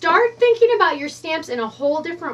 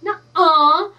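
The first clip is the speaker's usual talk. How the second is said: loud, higher "nuh-UH"; amazed disbelief; 4 semitones higher, 8 dB louder than usual. Retold or imitated. Crafter replaying her shock at a viewer's idea, loudly amazed. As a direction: burst out with a drawn-out, astonished denial. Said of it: "Like that".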